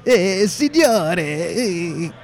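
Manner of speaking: high, drawn-out Italian waiter greeting; fake Italian accent. Waiter greeting a diner at the chef's table, fake Italian accent. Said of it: "These guys are doing fake Italian accents".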